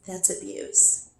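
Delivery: usual speaking voice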